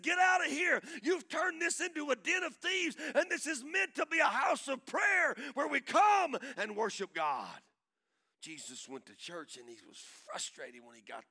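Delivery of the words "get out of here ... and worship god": loud, high righteous shout; holy anger. Acted. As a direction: thunder it like Jesus clearing the temple. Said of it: "this moment of holy anger".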